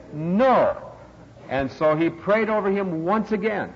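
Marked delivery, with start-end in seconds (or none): 0.1-0.7 loud, higher one-word refusal; raised, insistent reply; emphatic denial. Retold or imitated. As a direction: punch out a firm NO. Healed person's firm denial.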